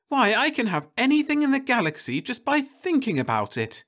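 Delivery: put-on high, giddy young woman; Florence's voice. A narrator's reading. Wife realizing her new power, high and giddy. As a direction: marvel at it in a high voice.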